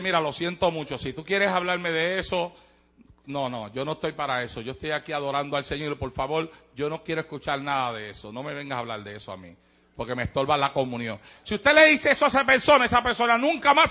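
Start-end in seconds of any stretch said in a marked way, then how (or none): 0.0-11.2 softer, lower gentle decline; polite refusal. Acted. Churchgoer declining to hear gossip, softer and lower. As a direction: turn her down kindly and quietly. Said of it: "Pero tú le dices".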